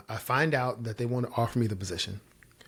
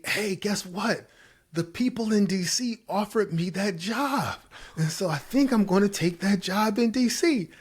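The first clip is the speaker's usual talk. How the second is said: high, gleeful retold announcement; excited announcement; 10 semitones higher, 3 dB louder than usual. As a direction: break big news in a high, eager voice. Acting out his big news.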